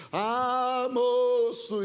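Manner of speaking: drawn-out mystical moan; suspenseful prophet. Retold or imitated. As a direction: stretch it out full of mystery. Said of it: "fazia um negócio assim, meio de suspense". (profeta fazendo suspense)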